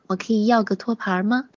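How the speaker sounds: ordinary delivery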